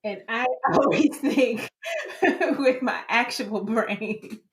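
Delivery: words broken up by laughter; laughing tease. Real, not acted. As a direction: tease while laughing, drawing out the punchline. Teasing punchline through own laughter.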